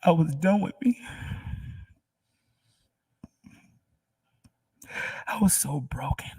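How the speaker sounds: fighting through tears, half whispered; crying. Real, not acted. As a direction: overcome and crying as she testifies. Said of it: "I'm crying".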